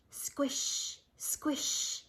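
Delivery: squishy footsteps. A narrator's reading. Leaves squishing under dragon's feet, soft breathy squish. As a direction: squelch each step under your breath. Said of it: "leaves beneath Dragon's feet went squish".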